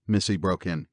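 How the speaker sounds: ordinary delivery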